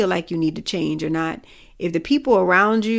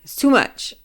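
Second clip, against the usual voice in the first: shaky, tearful voice; slower than usual. Acted. Speaker shows how they get when angry and frustrated, mock tearful. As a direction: imitate your own frustrated near-crying. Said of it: "voice get shaky, cry".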